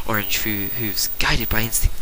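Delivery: usual speaking voice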